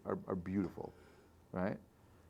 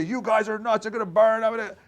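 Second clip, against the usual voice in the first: loud, high imagined scream of rage; a leader's scream he holds back, loud and high; mock scream; 10 semitones higher, 9 dB louder than usual. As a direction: yell it the way Moses never did. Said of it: "he doesn't scream and say".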